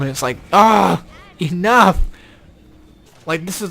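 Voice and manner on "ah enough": loud fed-up cry of enough; exasperated shout. Real, not acted. Gamer fed up with endless levels, exasperated shout. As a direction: groan it out, sick of the same rooms.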